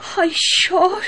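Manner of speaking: high, stunned cry of disbelief; shocked disbelief. Acted. Wife recognises lost husband, shocked. (হতবাক, উঁচু গলায় বিস্ময়)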